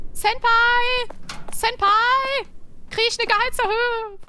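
high, cute calling out; chirpy anime call; cute anime streamer. Pretend. Calling out to boss character.

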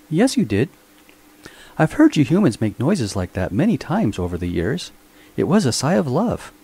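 high robot servant voice, primly knowing; a fussy mechanical butler pointing out the obvious. A narrator's reading. Robot Minx teases his master about a lovesick sigh.

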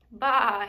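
a lamb's bleat heard across the valley; distant bleat. Acted. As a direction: call like a lamb out in the dark. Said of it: "he heard in the distance".

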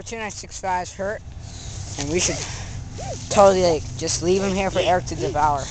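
ordinary talk

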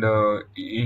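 ordinary talk, nothing marked

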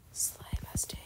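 soft secret whisper; whispered aside. Real, not acted. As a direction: lean in and whisper it.

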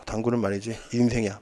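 low, hushed sage pronouncement; mock solemn senior. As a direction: deliver it like deep wisdom, quietly. Senior's solemn saying, deep and soft.

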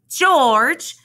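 loud, high call of a name; storybook farmer's wife calling out; excited calling out. A narrator's reading. Missus Rankins rushes up calling George.

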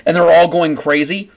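usual speaking voice

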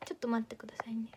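quick hushed whisper, fast. Real, not acted. Streamer asking chat to wait while checking, quick whisper.

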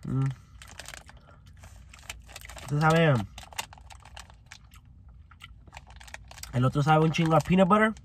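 chewing while talking; muffled words between bites; mouth partly full. Real, not acted. Seen 2: Bit bar just before; lips pursed, jaw working during words.

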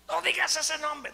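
high, loud demon shriek, drawn out; imitated demonic screech; possessed woman's voice. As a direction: scream the protest as the demon. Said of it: "la voz de ella había cambiado".

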